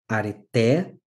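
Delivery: "areté" with the stress on the last syllable, TÉ; a-re-TÉ, demonstrating the tonic in "areté"; final-syllable stress. Shown as a demonstration. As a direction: let "areté" rise onto a stressed final té. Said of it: "percebam como a entonação, a tônica".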